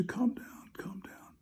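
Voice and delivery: whispered, soothing calm down; soft whisper to settle an angry man; soothing hush. Retold or imitated. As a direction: hush him gently like calming a child. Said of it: "finally calm him down and say".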